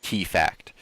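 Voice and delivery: ordinary delivery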